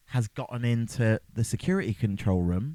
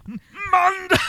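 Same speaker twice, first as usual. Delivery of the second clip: high, loud imitation yell; calling out; 8 dB louder than usual. Host copies co-host's shouted name, high mock call. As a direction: yell the name in a playful impression.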